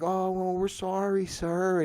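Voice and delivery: soft, placating customer-service voice; mock apology. Customer service rep placating a caller, mock apology. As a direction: imitate a meek, insincere apology.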